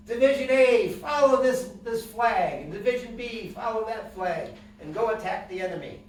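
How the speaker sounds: high, loud battlefield call, easing toward the end; banner holder giving orders. Acted. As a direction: shout commands to the divisions, then settle. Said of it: "So the banner would say".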